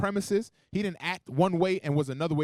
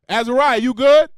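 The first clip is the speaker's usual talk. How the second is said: loud call across the room; 7 semitones higher, 11 dB louder than usual. Pretend. Preacher calling out to Azariah to show talking loud, loud call. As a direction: call out to him, much louder.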